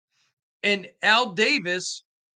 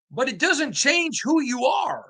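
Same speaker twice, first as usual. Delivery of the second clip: passionate shout; 7 semitones higher than usual. Real, not acted. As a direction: shout it in defense of the team. Defending the Raiders' legacy.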